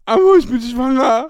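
high-pitched mock wail; imitated crying. Girlfriend breaks pregnancy news by phone, imitated wailing. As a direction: blurt it out in a pretend sob. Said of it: "die am Weinen ist".

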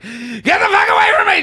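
high, loud sworn outburst; imitating a yell. Retelling a woman yelling at a skateboarder, loud high outburst. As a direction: snap at the skateboarder like a startled stranger. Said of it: "Just started yelling swears".

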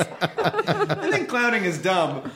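high, slow put-on clown. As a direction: play a sheepish clown wannabe. Playing a clown hopeful.

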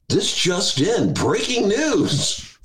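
slow, drawn-out mock breaking news; hammed-up news bulletin; mock newscaster. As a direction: announce it like a solemn anchor.

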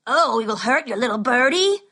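mock her sing-song; mocking bully. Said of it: "asked one mockingly".

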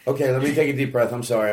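ordinary talk

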